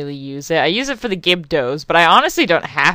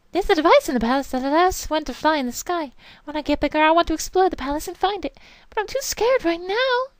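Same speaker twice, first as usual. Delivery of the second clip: put-on much higher, quick little kid voice; small child voice; 11 semitones higher, 3 dB quieter, faster than usual. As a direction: read it as a timid little child. Voicing a child character.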